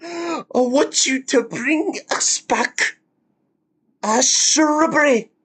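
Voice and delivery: high, mock-solemn knight voice; lightly voiced knight demanding a shrubbery; knights who say Nay. Streamer quoting the knights to chat, high mock-solemn voice.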